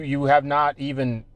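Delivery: usual speaking voice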